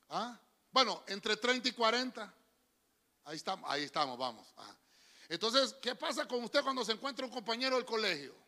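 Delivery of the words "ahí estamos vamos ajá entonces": usual speaking voice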